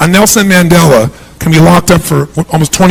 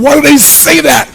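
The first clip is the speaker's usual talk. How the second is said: fretful self-doubt; 9 semitones higher than usual. Retold or imitated. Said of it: "you go".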